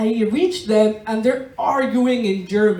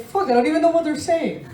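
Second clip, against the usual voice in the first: exasperated quote; 6 semitones higher, faster than usual. Retold or imitated. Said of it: "And I'm like".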